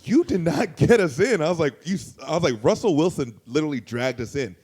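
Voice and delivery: loud, high retold protest; acting out an indignant comeback; indignant retort. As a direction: argue back as if wronged. Retelling his indignant argument back.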